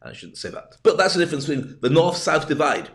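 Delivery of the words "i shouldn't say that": breathless under-the-breath aside; whispered aside. Real, not acted. The vlogger admits his joke went too far, in a whisper. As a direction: whisper it to yourself. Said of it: "probably insensitive".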